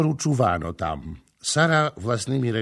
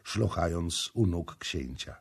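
ordinary delivery, nothing marked

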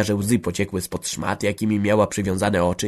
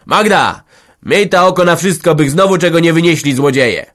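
loud, high call; shouting through the window; 8 semitones higher, 9 dB louder than usual. Acted. Man shouting through the window at Magda. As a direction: call out loudly to the room. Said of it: "krzyknął przez wywarte okno".

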